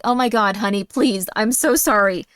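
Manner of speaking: acted exasperated mother's rant; overworked mom losing her patience; angry mom. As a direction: scold your child at wit's end. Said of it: "Why would you want one that's just like".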